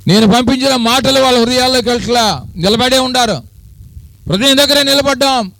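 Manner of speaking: high, loud preaching cry; fervent preaching. Acted. Preacher crying out to the congregation at full force. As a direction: lift the voice and preach it out.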